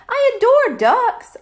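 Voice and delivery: high, loud gushing. Acted. Hypnotized mom gushes about ducks, high and loud. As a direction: swoon over ducks.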